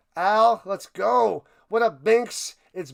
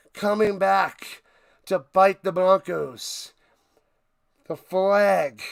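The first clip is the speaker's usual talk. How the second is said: usual speaking voice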